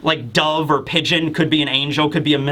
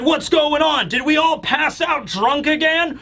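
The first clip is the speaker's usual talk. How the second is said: much higher, bewildered and worried; giant's pitched-up distressed questions; alarmed confusion; 9 semitones higher than usual. Retold or imitated. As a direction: wake up and find the ship missing. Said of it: "he's like".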